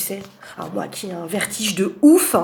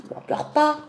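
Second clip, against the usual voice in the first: soothing pet-talk; 7 semitones higher than usual. Real, not acted. Soothing cat.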